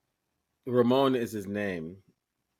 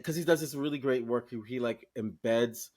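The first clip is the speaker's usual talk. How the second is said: ordinary delivery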